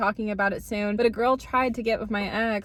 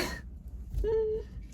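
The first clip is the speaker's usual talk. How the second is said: high, breathless giggle; embarrassed squealing laugh; 12 semitones higher, 9 dB quieter than usual. Real, not acted. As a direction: laugh out the awkwardness. Realizing friend heard everything.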